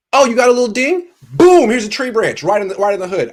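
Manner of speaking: high smug mock-deity voice; mocking God. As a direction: gloat like God dropping the branch on him. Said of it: "God saw me in my car and was like".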